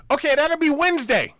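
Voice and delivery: loud and high, shouting at a customer; mock loud shop clerk; loud tone-deaf guy. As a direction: bellow a friendly greeting too loud. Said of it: "He talks to me like this".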